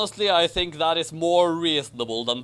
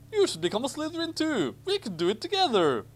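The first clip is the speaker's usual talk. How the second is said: much higher, gushing schoolboy; eager Ron voice; 9 semitones higher, faster than usual. Acted. Ron urging Harry to join Slytherin, high and eager. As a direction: sell it like an overeager new friend.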